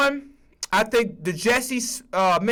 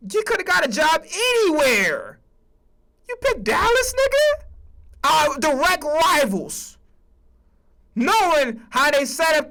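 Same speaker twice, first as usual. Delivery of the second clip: high-pitched, loud, incredulous; disbelieving rant; 8 semitones higher, 3 dB louder than usual. Real, not acted. Fan yelling at a coach who picked a rival, high and incredulous. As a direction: shout at him like he betrayed you. Berating Parker for picking Dallas.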